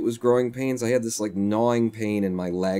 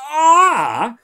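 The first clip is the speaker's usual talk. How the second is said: loud, high mock cry of pain; sudden pain cry; 9 dB louder than usual. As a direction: yelp as if a spike of pain hit. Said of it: "like, a spike of pain".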